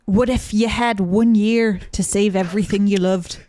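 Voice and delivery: put-on playful Scottish-accented tagline reading. Reading the book's tagline on request, in a put-on Scottish accent. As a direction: read it in a put-on Scottish accent, slightly Irish. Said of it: "obviously in an accent".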